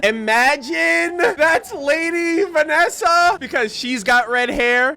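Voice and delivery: high, slow, drawn-out wonder; stretched-out, high-pitched wow; excited theory. Real, not acted. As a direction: float the idea in awe. Excited fan theory while watching.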